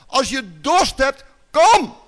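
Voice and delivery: loud rising cry, kom shouted high; shouting loud. Retold or imitated. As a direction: shout it through the temple. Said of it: "keihard in de tempel". (keihard roepen)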